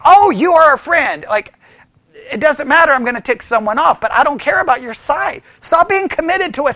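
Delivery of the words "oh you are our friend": mock friendly. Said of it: "they'd be like".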